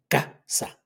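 "casa" in two syllables, CA-sa; stress on CA. Shown as a demonstration. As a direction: hit the first A of "casa", then a short gap. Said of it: "Lleva el acento en la primera A".

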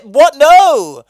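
loud, high exclamation; indignant outburst. Real, not acted. Host rejecting the idea of a put-on act, indignant shout. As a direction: shout it in outraged disbelief.

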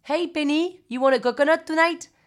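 imitated welcoming bar host; hailing bar owner. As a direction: call out to a regular customer. Voices bar owner greeting her.

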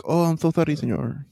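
put-on joking Spanish-accented waiter voice. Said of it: "I don't know why I did that accent".

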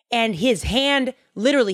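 normal talking voice

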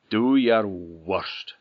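loud, slow defiant dare; slow and loud, daring the monster; rough grunting blacksmith. A narrator's reading. Blacksmith defies the Njogl.

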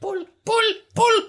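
very high creature squeak; kobold calling. Acted. Kobold urging friends above to pull, squeaky call. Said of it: "And then you just hear".

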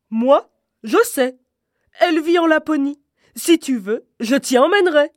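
loud, odd reindeer voice, keen to help; a young reindeer proudly offering a ride; eager reindeer voice offering to carry Gerda; little reindeer. Acted.

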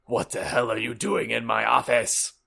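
angry Dumbledore. Acted. Dumbledore finding intruders in his office, mildly angry. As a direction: demand it as a cross headmaster.

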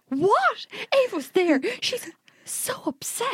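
high, shocked retelling; alarmed. Retold or imitated. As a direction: sound suddenly worried for her sister. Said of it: "And I was like".

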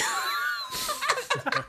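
high shrieking laugh. Real, not acted. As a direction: burst out laughing. Big laugh after quote.

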